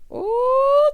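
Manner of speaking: a high, loud ooh of shock. Real, not acted. Reacting to the fight with a high, loud ooh.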